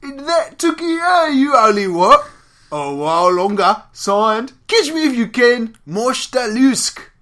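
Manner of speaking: very loud, high Cockney Ripper; Cockney accent. Acted. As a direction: belt it out as a mangled Cockney caricature. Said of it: "I like your Cockney accent".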